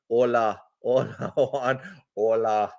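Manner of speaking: put-on a light, joking Spanish accent on the greeting; mock Spanish accent. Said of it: "That's my accent for my, my Spanish accent".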